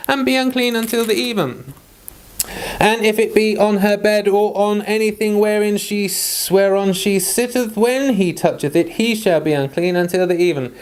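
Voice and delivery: steady, measured Bible reading; solemn scripture recital. Acted. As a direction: read the old King James verses aloud, evenly. Reads Leviticus chapter aloud.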